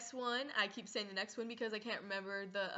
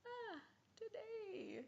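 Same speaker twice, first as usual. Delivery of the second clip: high, slow forced small talk; awkward mom voice; 10 semitones higher, slower than usual. Retold or imitated. Mom to daughter during sex scene, high awkward small talk. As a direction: play the mom filling silence nervously. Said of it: "your mom decides ... she's just like".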